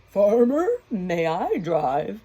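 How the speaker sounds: loud, neighing horse voice, slow question; whinny it to the farmer. Acted. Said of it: "The horse neighed".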